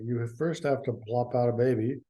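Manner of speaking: usual speaking voice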